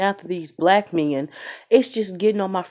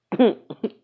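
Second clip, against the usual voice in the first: amused chuckle. Real, not acted. Laughing at her own tangent, short chuckle. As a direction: chuckle to yourself. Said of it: "own laugh".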